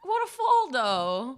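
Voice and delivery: sudden high shout of shock; shocked cry. Real, not acted. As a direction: yelp it out as he falls. Gasping at a climber's fall.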